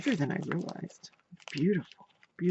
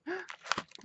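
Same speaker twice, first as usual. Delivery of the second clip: soft gasp; 6 dB quieter than usual. Real, not acted. New gift revealed.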